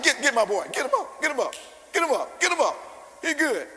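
the king urges his servants to hurry; excited king. Retold or imitated. King frantically orders Daniel out.

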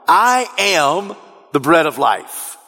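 weighty stress on "I am", drawn out slowly; emphatic. Retold or imitated. Jesus addressing the crowd about the bread, emphatic. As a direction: land "I am" slowly, then finish the phrase evenly. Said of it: "Jesus says emphatically to the crowd".

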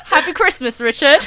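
high British voice through laughter; silly high-pitched British accent; mock British accent. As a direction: giggle out a hammed-up British greeting. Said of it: "when you do a British accent".

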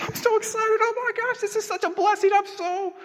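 very high squealing winner, hammed-up; hysterical happy crying. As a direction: wail with joy in a squeaky mock sob. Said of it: "they just start crying".

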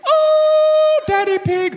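squealing very high and loud little piglet; hammed-up squeaky cartoon piglet; Peppa Pig. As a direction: shriek it like an excited cartoon girl. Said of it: "Peppa Pig goes".

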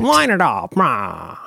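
mock a gangster-movie star, stretching the words; Edward G. Robinson. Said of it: "talking like a bad impersonation of Edward G".

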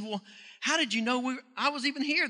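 high, astonished survivor asking; amazed question. Retold or imitated. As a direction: ask it in disbelief, lifted up. Amazed survivor voiced in story.

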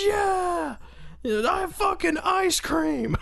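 high squealing cheer of an excited crowd; mock crowd cheer. Wrestling fans cheering ice cream bars, high mock cheer. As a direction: shriek it like fans gone wild over ice cream. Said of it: "everybody's like".

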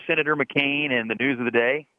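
ordinary talk

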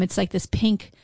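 ordinary delivery

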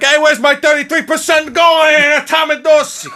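high, loud hammed-up angry yell; pissed-off Frankie. Frankie demanding his cut from Dorsey, hammed-up angry shout. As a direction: holler it like a cheated Italian-American wiseguy. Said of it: "Frankie was pissed 'cause he's just like".

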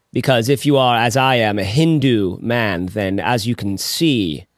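low, slow, drawn-out philosopher voice; Alan Watts impression. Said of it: "my attempt at Alan Watts".

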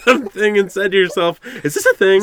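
loud, high, laughing tagline; excited tagline. Acted. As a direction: belt out the catchphrase with a laugh. Reading the show tagline.

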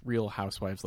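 ordinary talk, nothing marked

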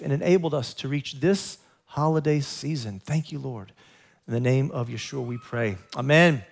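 hushed liturgical prayer. Acted. Blessing prayer in Hebrew, English.

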